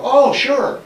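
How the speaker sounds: light up as if you know him; recognition. Retold or imitated.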